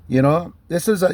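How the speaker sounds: ordinary delivery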